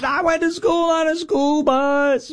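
high, slow mock shout; mocking Kamala. Host mocking Kamala's school bus line, high slow shout. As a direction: belt it out slowly in a mocking high voice. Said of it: "And she talked about".

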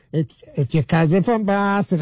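slow, teasing mock Boston accent. Entertaining himself on the toilet, mock Boston accent. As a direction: stretch it out in imitation Bostonese. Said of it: "making fun of, like, the, the Boston accent".

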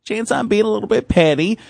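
petty inner voice. Retold or imitated. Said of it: "you go".

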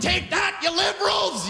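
high-pitched mock taunting shout; hammed-up war cry; mock battle cry. Imagined Albertans attacking with covid.

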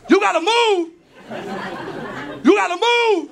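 mock airport officer yelling; yelling traffic cop. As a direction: bellow it like a cop waving cars along.

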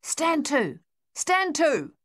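high, loud called order; captain shouting an order along the line; calling along the line. Acted. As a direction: call it down the trench to the men. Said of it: "he called along the line".

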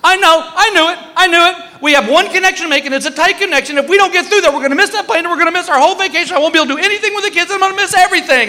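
high, fast, rattled rant; panicky wife. Retold or imitated. Wife to husband about missing the connection, panicking. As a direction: spiral into a high-pitched mock panic.

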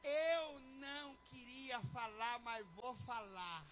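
slow, stretched-out mock reluctance; drawn-out reluctance. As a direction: drag each word out before giving in. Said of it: "É bem assim".

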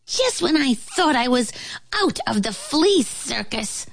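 muttering. A narrator's reading. Flea complains about the ant, high muttering. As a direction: grumble it in a small high voice. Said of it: "muttered the flea".

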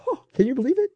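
high, gasping mock wonder; acted amazement. Preacher voicing onlookers watching creation, acted amazement. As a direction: marvel at it in a high voice.

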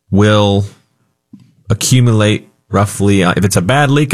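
usual speaking voice

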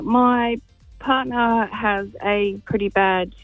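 ordinary talk, nothing marked

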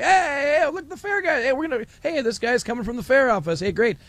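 high-pitched, eager hail to a newcomer; excited hailing. Retold or imitated. Retelling hosts greeting the fair official on air, excited. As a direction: call out "hey" like a delighted radio host.

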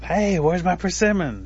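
high, loud, drawn-out complaint; acted impatient shout up a tree; demanding call. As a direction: holler up for your missing share. Turtle calls up once more.

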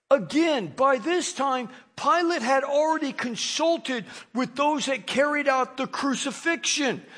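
usual speaking voice

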